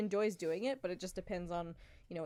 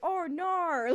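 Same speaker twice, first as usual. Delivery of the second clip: loud, high mock-Aussie "nar"; bad Australian accent; 9 semitones higher, 6 dB louder than usual. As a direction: overdo the Aussie R on "Oh, no". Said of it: "add an R to the end of your words".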